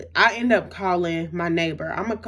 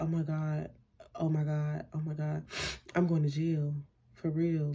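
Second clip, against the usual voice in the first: mock-panicked low mutter, much softer; hushed "this can't be happening" aside; retold stunned muttering; 3 semitones lower, 10 dB quieter than usual. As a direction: mutter it to yourself in dread. Retelling panicked muttering to self.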